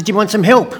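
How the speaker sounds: speedboat driver. Retold or imitated. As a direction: call out an offer of help. Speedboat driver offers help.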